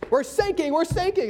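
lightly voiced frantic sailor; mock panic. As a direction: cry for help from a sinking ship. Sailor repeating call.

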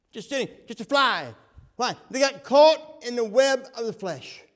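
loud, slow preacher's shout; preaching at full force. Acted. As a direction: drive it home to the congregation.

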